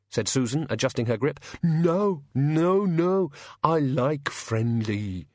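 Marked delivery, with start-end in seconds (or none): 1.6-5.3 high monster protest slowing down; pleading creature voice; cowed bogeyman. Acted. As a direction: back down as a scared bogeyman, dragging the last words. Bogeyman backs down hastily.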